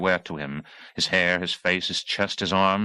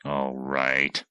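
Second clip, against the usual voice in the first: low hateful mutter; 6 semitones lower, 3 dB quieter than usual. Acted. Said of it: "squinting with hate".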